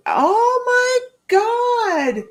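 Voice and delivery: real crying breaking through the words; choking up. Real, not acted. Moved to tears by a viewer's comment, crying. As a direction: voice breaking into tears, high and loud on oh my God. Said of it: "You're gonna make me cry".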